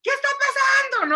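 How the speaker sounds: high, loud alarmed demand; acted-out shouting parent; exasperated yelling. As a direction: burst in yelling at your husband. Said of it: "yo voy a estar ahí así de".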